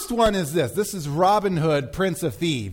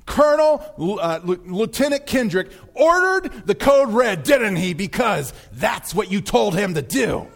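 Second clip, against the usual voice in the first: raised, accusing courtroom cross-examination; Tom Cruise impression; 3 semitones higher than usual. Lawyer questioning the colonel in court, mounting accusation. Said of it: "And then he continues".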